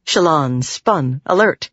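plain reading voice

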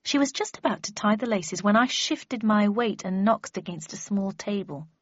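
usual speaking voice

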